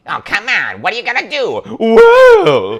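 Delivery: high, loud, hammed-up exclaiming; cartoon character voice. Fan acting out the show's characters, high loud cartoon voice. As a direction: pitch it way up and swell into a big "well".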